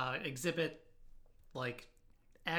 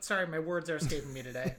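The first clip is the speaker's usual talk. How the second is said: usual speaking voice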